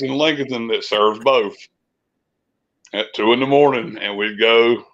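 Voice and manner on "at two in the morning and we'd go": ordinary talk, nothing marked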